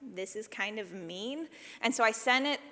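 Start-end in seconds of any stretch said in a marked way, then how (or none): 0.0-1.5 muttered much softer and slower, half to herself; sheepish aside. Retold or imitated. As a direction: say it low like a guilty admission. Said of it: "And I was like".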